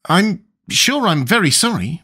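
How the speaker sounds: put-on a polite little girl saying sorry; lightly voiced young Alice; Alice's voice. Alice speaks.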